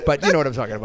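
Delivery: usual speaking voice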